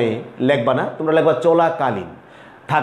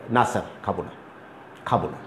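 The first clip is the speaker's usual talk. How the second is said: low, soft student reply; student's curt answer; 8 semitones lower, 10 dB quieter, faster than usual. Shown as a demonstration. Student answering the teacher, low and soft. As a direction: mumble a quick no like a pupil. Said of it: "তুমি আমাকে সাথে সাথে হয়তো উত্তর দিবে".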